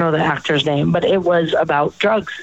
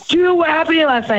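higher-pitched friend demanding an explanation; mock indignant; 8 semitones higher, faster than usual. Friends questioning someone who skipped goodbyes, mock indignant. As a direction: imitate a put-out pal. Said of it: "Some people get mad".

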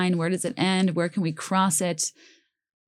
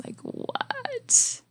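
baffled whisper; 6 dB quieter than usual. Real, not acted. Baffled by a lyric.

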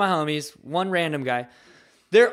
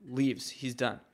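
ordinary talk

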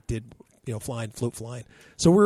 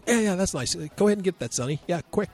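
higher, louder breezy urging; buddy's voice; 6 semitones higher, 3 dB louder, faster than usual. Retold or imitated. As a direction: Pete hurries the kid, unbothered. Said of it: "my buddy Pete".

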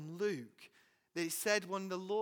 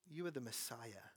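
much softer, lower reverent confession; hushed confession; 7 semitones lower, 11 dB quieter than usual. Acted. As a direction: say it softly in awe, fading away.

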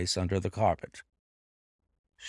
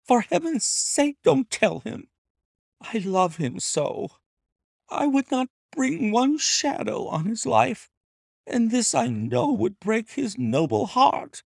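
much higher, urgent begging voice; Lady Hilda begging Holmes to keep the secret, much higher; pleading lady; 12 semitones higher than usual. A narrator's reading. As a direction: plead for your husband's peace.

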